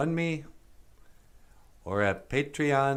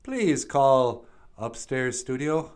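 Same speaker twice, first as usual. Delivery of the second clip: ordinary talk, nothing marked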